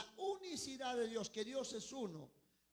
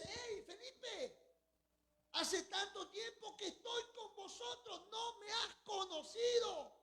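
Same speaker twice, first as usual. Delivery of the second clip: playful Jesus voice; 8 semitones higher than usual. Acted. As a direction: tease Felipe gently like an old friend. Preacher voices Jesus speaking to Philip.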